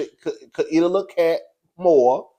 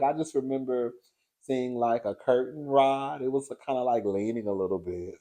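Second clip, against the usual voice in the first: mock classy read; 4 semitones lower, 11 dB quieter than usual. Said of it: "And she was like".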